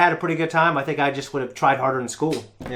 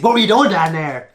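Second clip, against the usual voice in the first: mock indignant demand, a bit louder; retold angry dad; 3 semitones higher, 4 dB louder than usual. Dad confronting kid in the kitchen, mock angry. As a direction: imitate a dad demanding answers.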